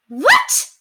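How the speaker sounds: high, loud shocked yell; outraged mistress yelling at the card. Acted. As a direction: shout it in disbelief. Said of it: "she yelled".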